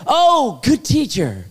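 high-pitched gushing "oh"; mock gushing. Preacher imitating the ruler greeting Jesus, mock gushing.

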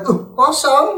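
high-pitched pretend shock; mock dismay. Host acting out viewers finding an empty wallet, mock dismay. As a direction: jump up in pitch as if caught out.

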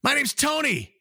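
imitated far-off shout; distant dog yelling back. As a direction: answer from across the neighborhood. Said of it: "the dog in the distance goes".

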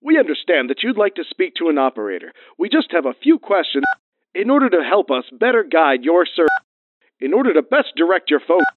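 polished recorded hotline voice; phone menu voice. Acted. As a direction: read it like an automated menu.

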